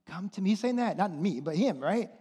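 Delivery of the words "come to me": gentle voice of God. Retold or imitated. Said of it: "and God is saying".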